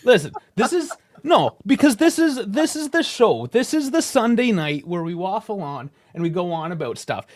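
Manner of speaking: high, loud, laughing rant; heated mock rant. Real, not acted. Co-host rants at host about the show, heated and half joking. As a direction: fire back at him, half joking.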